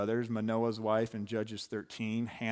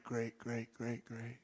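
fading echo; 9 dB quieter, slower than usual. Acted. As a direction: repeat the word softly, like an echo. Echoing the end of quoted promise.

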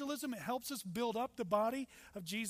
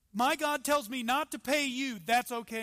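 mock insistence; 4 semitones higher, 8 dB louder than usual. Mock argument with the cabbie.

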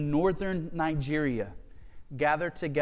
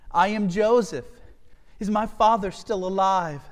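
raised voice as Joseph revealing himself; impassioned scripture reading; 4 semitones higher, 6 dB louder than usual. A narrator's reading. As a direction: read Joseph's words higher, with strong feeling. Reads Joseph's words from scripture.

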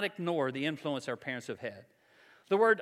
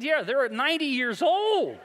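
laughing retort; 7 semitones higher, 5 dB louder than usual. Real, not acted. Laughing disbelief at the comparison.

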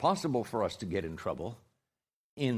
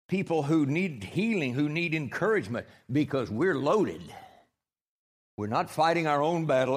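full-force preaching; 4 semitones higher than usual. Acted. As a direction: thunder it at the congregation.

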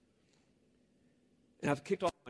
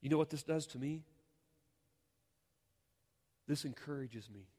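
normal talking voice

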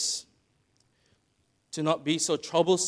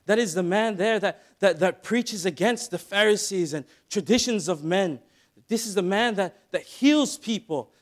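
higher and louder, an eager bystander pointing Jesus out; excited crowd chatter; 5 semitones higher, 5 dB louder than usual. Acted. Preacher voicing the crowd to blind Bartimaeus, excited. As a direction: call out like a thrilled onlooker spotting Jesus.

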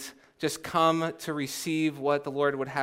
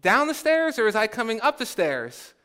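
higher-pitched puzzled holler; mock yell; 5 semitones higher than usual. Middle sister halted halfway up the stairs, mock yell. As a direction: call out in comic confusion. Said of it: "and then she yells".

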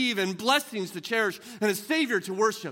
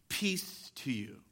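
soft, slow, hushed peace to you; tender quoting of Jesus; 3 semitones lower, 9 dB quieter, much slower than usual. Acted. Preacher voices Jesus greeting frightened disciples, soft and tender. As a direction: breathe it gently like a blessing.

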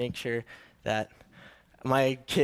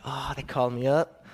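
puffed-up official tone, self-mocking; a put-on official voice, gently teasing himself; 3 semitones lower than usual. Shown as a demonstration. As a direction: play it grand and important, tongue in cheek. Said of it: "I sound so official".

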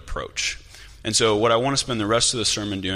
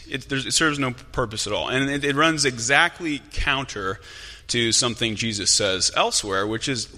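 ordinary talk, nothing marked

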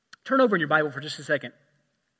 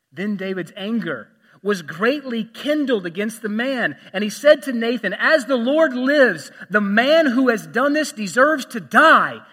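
forceful scripture reading; 5 semitones higher, 8 dB louder than usual. Acted. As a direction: read it like thunder from the pulpit.

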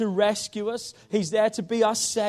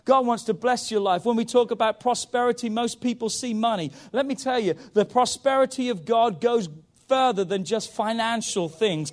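ordinary talk, nothing marked

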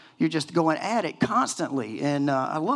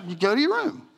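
high mock scolding parent; playful parent Jesus; 10 semitones higher, 3 dB louder than usual. Jesus sends disciples to their rooms, high and mock stern. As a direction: send them to their room like kids. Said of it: "he's told his disciples".

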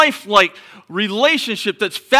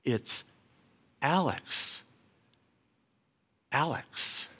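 gently calling a name, very soft; 5 semitones lower, 13 dB quieter, slower than usual. Acted. God quietly calling someone's name, very soft. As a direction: call the name like a quiet inner voice.

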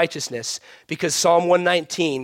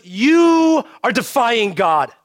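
slow, loud, higher proclamation; forceful declaration; 6 semitones higher, 5 dB louder, much slower than usual. Retold or imitated. Preacher voicing Jesus, slow and loud. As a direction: shout it out slowly.

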